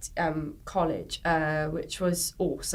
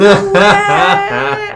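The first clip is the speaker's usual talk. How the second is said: very high, loud burst of revelation; hammed-up, squealed excitement; amazed delight; 13 semitones higher, 15 dB louder than usual. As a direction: shout it like a sudden discovery, laughing. Acts out discovering the tab key.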